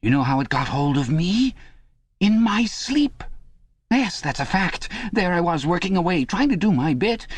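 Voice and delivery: Parsons, nervous and pleading. Acted. Parsons begging Winston in the cell, high anxious pleading.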